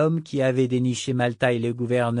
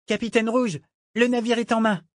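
put-on high young cabin boy voice; boyish sailor announcing; 11 semitones higher, 3 dB louder than usual. A narrator's reading. Young sailor announcing the captured ship, eager report. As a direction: report to the captain in a high boy's voice. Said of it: "annonça un matelot, presque un gamin".

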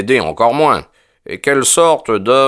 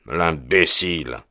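bitter; 2 semitones lower, 4 dB quieter than usual. A narrator's reading. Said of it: "dit Holmes avec amertume".

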